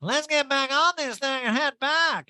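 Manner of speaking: high, slow mocking voice; imitating Erica on the ski trip. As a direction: stretch it out up high. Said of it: "And Erica goes".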